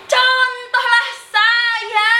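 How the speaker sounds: high, very drawn-out proclamation; grandiose boast. Acted. Performer offering himself as the example, grandiose high proclamation. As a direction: stretch each word like a showman.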